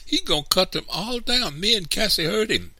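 put-on very high, loud child's voice; high-pitched, urgent report; protesting boy. A narrator's reading. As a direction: blurt it out like an upset boy. Boy urgently tells on the man.

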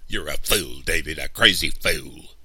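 loud, angry insult; cursing. A narrator's reading. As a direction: curse him out as you give up. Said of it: "cursing Papa".

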